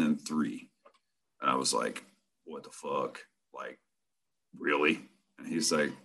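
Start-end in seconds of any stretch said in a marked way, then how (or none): 2.4-5.0 low, very soft muttered disbelief; stunned disbelief. Retold or imitated. Newcomer doubting the pay offer, quietly stunned. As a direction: murmur it low, barely believing it.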